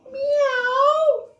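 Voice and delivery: very high, loud meow; cats meowing. Acted. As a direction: yowl it like a cat on a wall. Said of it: "sang the cats on the wall".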